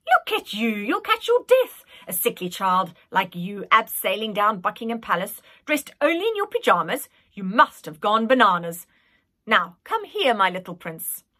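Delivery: put-on fussing old nanny. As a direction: scold him kindly like a doting nanny. Nanny fussing over boy.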